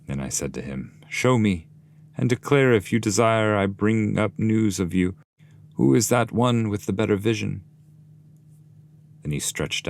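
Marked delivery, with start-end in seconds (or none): 1.1-7.6 lightly raised, eager asking voice; questioning traveller. Acted. Dante asks the shade to name him, lightly raised. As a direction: press the shade for an answer.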